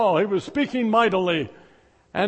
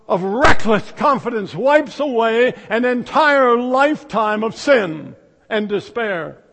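usual speaking voice

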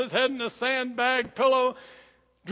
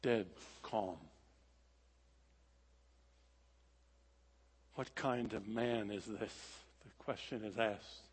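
hushed sermon close; 11 semitones lower, 15 dB quieter than usual. Acted. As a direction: let the hush fall after the storm. Hushed closing narration.

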